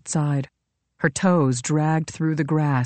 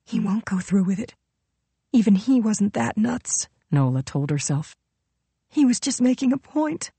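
frightened inner voice; 5 semitones higher than usual. Acted. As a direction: talk herself into hope. Her inner thoughts.

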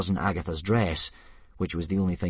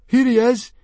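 much higher, very loud yell; 13 dB louder than usual. Acted. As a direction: yell it out pointing him out. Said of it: "yelled Fishlegs".

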